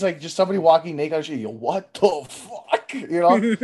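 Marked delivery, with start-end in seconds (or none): 1.4-2.9 slow, stunned and softer; slow stunned yo what the fuck. Retold or imitated. Seeing someone naked on the street, slow stunned disbelief. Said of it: "you see titties".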